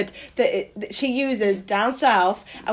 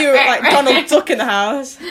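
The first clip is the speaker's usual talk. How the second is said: teasing through laughter; laughing; 2 semitones higher, 6 dB louder than usual. Real, not acted. Teasing friend about her duck impression, laughing. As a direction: laugh out the Donald Duck jibe.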